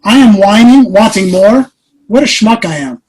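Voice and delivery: amazed self-reproach. Retold or imitated. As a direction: pile up undeserved blessings, scolding yourself. Acting out his sudden self-reproaching realization.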